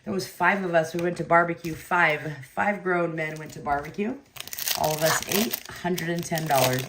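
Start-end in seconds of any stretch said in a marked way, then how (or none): none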